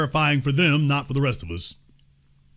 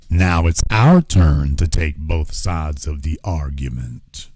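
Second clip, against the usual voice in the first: much lower, louder cartoon-villain gloat; hammed-up evil mastermind; mock villain voice; 13 semitones lower, 6 dB louder than usual. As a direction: play the boss of an all-villains cast, deep and smug. This is an all-villains cast.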